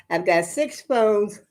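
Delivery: ordinary talk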